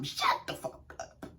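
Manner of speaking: whispered, unvoiced and sharp; hissed whisper. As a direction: spit it out in a breathy whisper. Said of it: "Heavenly looked at her and said".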